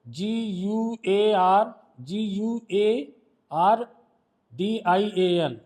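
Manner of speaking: very slow, letter by letter; drawn-out letters with gaps between them; spelling out. Shown as a demonstration. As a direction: spell it out slowly for a class. Teacher spells out word for class.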